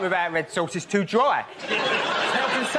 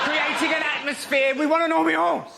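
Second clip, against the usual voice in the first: higher, laughing mock shout of posh diners; 8 semitones higher than usual. Comic mocking posh diners, higher laughing call. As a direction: lampoon the middle class.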